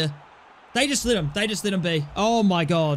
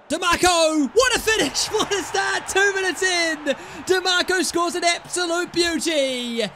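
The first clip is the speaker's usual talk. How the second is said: long goal yell; 10 semitones higher than usual. Real, not acted. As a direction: belt out the goal call. Early goal scored.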